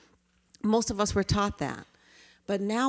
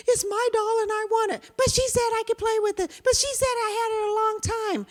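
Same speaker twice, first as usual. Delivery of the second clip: put-on much higher, louder kid voices squabbling; arguing children; 4 dB louder than usual. Children arguing to the teacher over a doll. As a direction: whine it like kids fighting over a toy.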